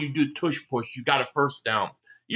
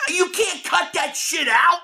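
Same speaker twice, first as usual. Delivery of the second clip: angry rant; 7 semitones higher, slower than usual. Real, not acted. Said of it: "It just pisses me off".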